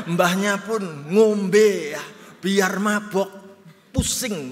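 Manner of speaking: loud, slow, laughing tease about grandma drinking. Retold or imitated. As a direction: laugh while you tell it.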